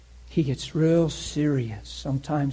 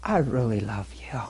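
tender whisper; 4 semitones lower, 6 dB quieter than usual. Retold or imitated. God whispers love to the weeping preacher. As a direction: breathe it out gently. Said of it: "he's saying stuff like".

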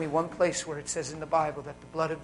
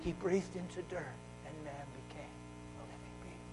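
hushed preaching; 2 semitones lower, 12 dB quieter than usual. Acted. Preacher telling the congregation of creation in an awed hush. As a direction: drop to a reverent hush.

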